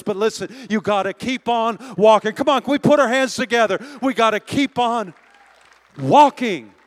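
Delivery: preaching at full force. Acted. Pastor rousing the congregation, building to a shout. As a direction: drive it home to the crowd.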